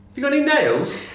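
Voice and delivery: high voiced rabbit asking for carrots; talking rabbit. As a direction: pipe up high like a tiny rabbit. Joke, rabbit punchline setup.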